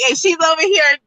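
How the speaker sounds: breaking into high laughter; laughing. Real, not acted. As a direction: laugh through the words. Laughs mid rant.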